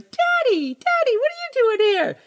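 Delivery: very high, squealed girlish impression; imitating panicked daughter. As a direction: play a flustered girl squealing at her dad. Panicked daughter runs up to dad.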